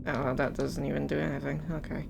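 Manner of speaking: ordinary talk, nothing marked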